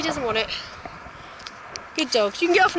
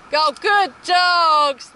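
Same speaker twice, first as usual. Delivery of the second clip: drawn-out praise; 4 semitones higher, 4 dB louder, much slower than usual. Real, not acted. Drawn-out praise during photo.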